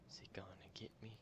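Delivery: tense fearful whisper; scared horror game streamer. Real, not acted. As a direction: whisper it, afraid of being caught. Creeping through horror game.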